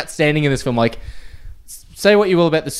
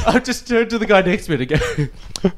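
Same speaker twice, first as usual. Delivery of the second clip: ordinary delivery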